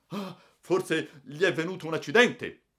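acted alarm, pitched well up; alarmed query. A narrator's reading. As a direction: shake the sleeper awake with urgent concern. Railway man shakes a sleeper awake.

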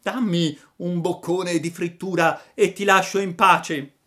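high, loud begging hound voice; whining dog. A narrator's reading. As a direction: plead like a whimpering, hungry dog. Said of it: "mugolando e dimenando la coda". (voce di cane che mugola)

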